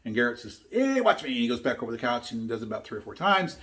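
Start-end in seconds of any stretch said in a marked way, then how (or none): 0.7-1.3 put-on high-pitched cheeky boy showing off; mock a daredevil kid, voice jumping way up; playful, high kid's boast; defiant kid. Defiant boy quoted to brother.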